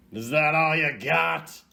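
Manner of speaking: cocky taunt at the opponent; mock taunt. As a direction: dare them to hit harder. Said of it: "'cause it's like".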